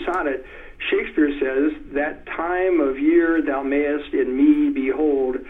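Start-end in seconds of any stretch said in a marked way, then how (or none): 1.9-5.4 slow iambic reading, every second syllable hit; emphasized meter. Shown as a demonstration. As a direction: lean on each stressed syllable, slowly. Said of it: "I emphasize it a little more".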